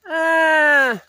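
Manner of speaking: sharp high wailing cry, loud; imitated child's cry. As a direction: mimic a child's sudden cry. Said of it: "the sound of a child crying".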